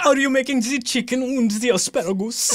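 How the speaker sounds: put-on laughing Austrian accent. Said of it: "go crazy with this voice".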